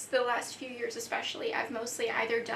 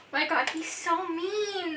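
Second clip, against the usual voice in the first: shocked gasp; 5 semitones higher, 4 dB louder, slower than usual. Real, not acted. Gasping at mean character.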